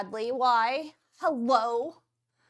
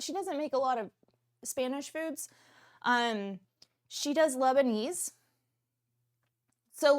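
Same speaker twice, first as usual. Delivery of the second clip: ordinary talk, nothing marked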